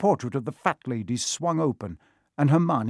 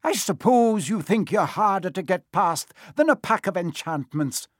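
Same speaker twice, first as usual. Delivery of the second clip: higher, sharp angry scolding; stormed; 7 semitones higher than usual. A narrator's reading. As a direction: scold them like a furious teacher. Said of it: "she stormed".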